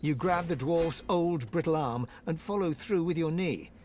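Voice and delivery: normal talking voice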